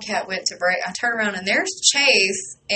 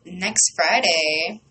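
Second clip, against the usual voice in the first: ordinary talk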